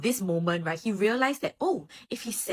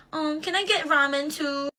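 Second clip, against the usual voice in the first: high, slow wheedling request; whiny ask; 8 semitones higher than usual. Retold or imitated. Sulking captain wheedling his friend for ramen, high and slow. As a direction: push your luck sweetly. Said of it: "Oikawa will be a little bitch and be like".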